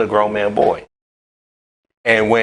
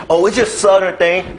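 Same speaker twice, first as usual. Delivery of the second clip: mock excuse; 10 semitones higher than usual. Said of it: "I don't care".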